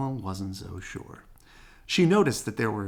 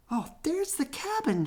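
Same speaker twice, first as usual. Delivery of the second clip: put-on high, thrilled little girl calling out; excited shout. A narrator's reading. Sister spotting the cabin from the car, thrilled. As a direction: spot it and shout with delight. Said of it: "shouted Sister".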